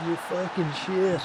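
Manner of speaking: high, sneering yell at the players; mock berating yell. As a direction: let them have it, half joking. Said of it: "Let's berate them".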